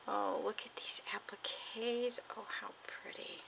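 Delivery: breathless hushed whisper of delight; soft awed whisper over pretty things. Real, not acted. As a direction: whisper it as if afraid to break the spell. Delighted whisper over craft gifts.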